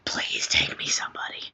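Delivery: pleading whisper. Acted. Secretly begging to go.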